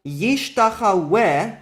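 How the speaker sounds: yishtachaweh said slowly, the waw sounded as a consonant w; slow example word. Shown as a demonstration. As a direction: spell out yishtachaweh slowly, with a clear w before the final e. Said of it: "for example".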